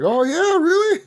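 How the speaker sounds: very high mock-incredulous taunt; mock scorn. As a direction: imitate a drunk hurling back an insult. Acted bar quarrel.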